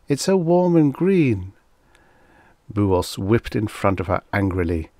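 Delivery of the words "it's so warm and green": high, happy airy-creature voice. A narrator's reading. She-creature enjoying the green planet, happy. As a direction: delight in it, high and light. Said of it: "she said happily".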